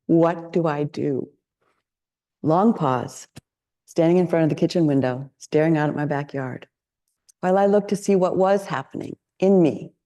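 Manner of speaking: ordinary delivery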